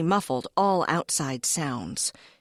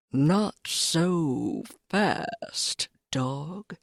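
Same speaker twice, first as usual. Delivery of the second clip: sly cat voice; much slower than usual. Acted.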